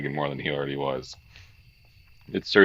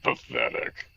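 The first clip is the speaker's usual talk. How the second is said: lower, quieter muttered scorn; low contemptuous repeat; 4 semitones lower, 4 dB quieter, slower than usual. Acted. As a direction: drop it under your breath with disdain. Accusing character sneers twice.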